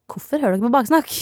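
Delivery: teasing question to the listening parents, said lightly; mock scolding. To Sara's parents, mild mock scolding. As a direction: gently tease them for eavesdropping. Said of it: "hvis mammaen og pappaen til Sara hører på nå".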